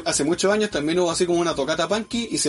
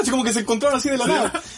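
ordinary talk

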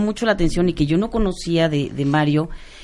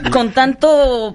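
laughing; 4 semitones higher, slower than usual. Real, not acted. Guest jokes, laughing.

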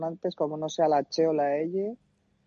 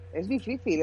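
ordinary talk

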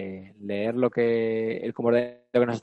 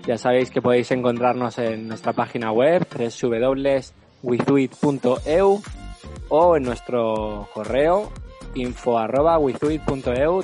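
upbeat sign-off announcer; podcast outro; 2 semitones higher, 5 dB louder than usual. Acted. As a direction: wrap up the show brightly. Outro sign-off over music.

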